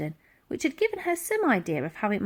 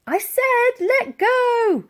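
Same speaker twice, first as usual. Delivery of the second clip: high, loud, slow repeat; girl repeating her order to the old lady; shouting; 6 dB louder, much slower than usual. A narrator's reading. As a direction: spell it out over the din.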